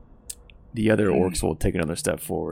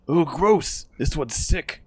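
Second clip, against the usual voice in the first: high, loud recoiling "gross"; disgusted armored orc; 8 semitones higher, 5 dB louder, slower than usual. Acted. Armored orc to his gang, high disgusted recoil. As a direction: pull back in mock disgust. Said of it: "he's like".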